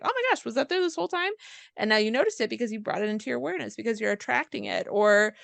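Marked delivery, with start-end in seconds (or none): 0.0-1.3 high, quick astonished gasp of a line; surprised. Retold or imitated. Friend retelling spotting a shop: high quick astonishment. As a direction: sound amazed at suddenly noticing it. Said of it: "And it's just like".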